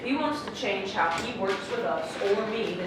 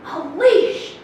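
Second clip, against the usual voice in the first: incredulous; 5 semitones higher, 10 dB louder than usual. Acted. Incredulous retort to being called leash.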